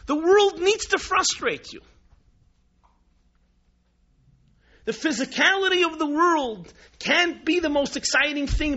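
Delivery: high, loud, driving sermon voice; fervent preaching. Acted. Rabbi preaching to his listeners, loud and fervent. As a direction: preach it out to the crowd with fire.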